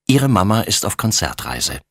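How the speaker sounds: plain reading voice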